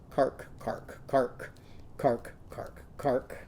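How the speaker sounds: breathy unvoiced cark barks; whispered bark. Shown as a demonstration. Teacher whispering puppy barks to the class. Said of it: "Crazy's pups are saying".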